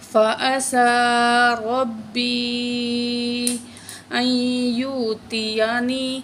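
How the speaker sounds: slow melodic tilawat; full-verse tajweed recitation; Quran recitation. Acted. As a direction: chant the verse in measured recitation.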